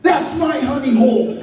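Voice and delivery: high, loud mock-outraged holler; retold territorial yell. Friend yelling at a rival dumpster diver, mock outrage. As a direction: holler it like someone guarding a prized spot.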